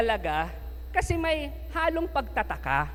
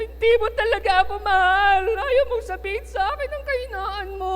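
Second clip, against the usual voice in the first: high, put-on sobbing plea; mock crying Delilah; 8 semitones higher, 4 dB louder than usual. Delilah crying at Samson that he does not love her, hammed-up sobs. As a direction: fake tears to guilt him. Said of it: "Umiyak ulit".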